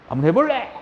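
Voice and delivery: high, loud taunting challenge in a put-on voice; cocky dare. Shown as a demonstration. Teacher voicing a brave person squaring up, a cocky dare. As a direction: goad them into a fight. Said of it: "이렇게 받쳐 올립니다".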